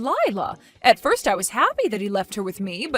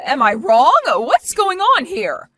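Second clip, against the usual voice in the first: much higher, louder, rising panic; alarmed confusion; 9 semitones higher, 4 dB louder than usual. Acted. Martha holding a baby that can't be Sally's, high alarmed confusion. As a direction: voice climbing as it sinks in.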